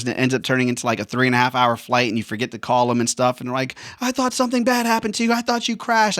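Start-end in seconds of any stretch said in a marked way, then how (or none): 4.0-6.2 high-pitched imitation of a worried relative; mock a panicked, worried loved one; freaked-out worry. Women at home to the late pilot, freaking out. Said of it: "they, they freak out".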